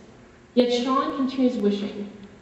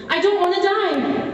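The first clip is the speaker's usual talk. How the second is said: higher, louder, slowed plea; desperate cry; 9 semitones higher, 4 dB louder, slower than usual. A narrator's reading. Sean wakes from the dream, crying out desperately. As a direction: cry out for your life. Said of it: "I desperately".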